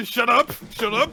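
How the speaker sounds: high, loud frantic shut-up; flustered mock shushing. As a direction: cut the blabber off in a panic. Imitates flustered Damien silencing Anya.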